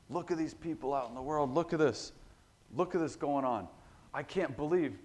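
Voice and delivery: indignant finger-pointing. Acted. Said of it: "you've heard this spirit before".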